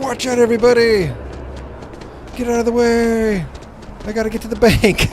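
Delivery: high, loud panicked shouting; frantic yell. Pretend. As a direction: yell like you're late for the bank. Player voices frantic dash through crowd.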